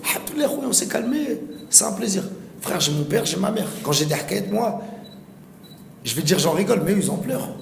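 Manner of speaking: lively, high-pitched retort to critics; animated retort. Retold or imitated. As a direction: fire back brightly at the critics. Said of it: "Je dis".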